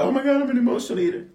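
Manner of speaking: high, fast, mock-dramatic wail; mock dramatic confession. Preacher mimics congregants who feel like emotional eaters, high and mock-dramatic. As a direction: send up the overwhelmed emotional eater. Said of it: "for all of you felt like".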